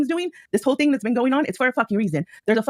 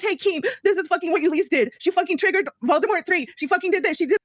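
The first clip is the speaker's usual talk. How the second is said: tattling quote; 7 semitones higher than usual. Retold or imitated. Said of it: "steps up and says".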